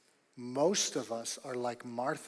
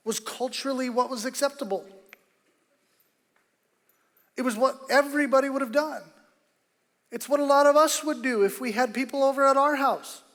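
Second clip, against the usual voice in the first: higher, louder pulpit build; preaching at full force; 11 semitones higher, 10 dB louder than usual. Acted. Preacher pressing the church on Martha, louder and higher. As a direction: drive the point home to the congregation.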